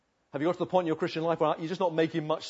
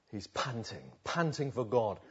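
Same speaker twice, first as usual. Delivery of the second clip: ordinary talk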